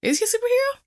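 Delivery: asking again, truly surprised he is a hero; incredulous. Real, not acted. Repeats the question in disbelief.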